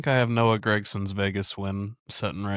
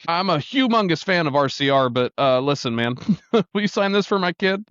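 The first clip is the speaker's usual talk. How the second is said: playing it cool; 8 semitones higher than usual. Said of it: "trying to play it off like".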